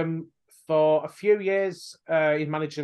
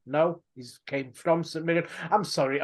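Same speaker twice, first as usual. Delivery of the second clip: usual speaking voice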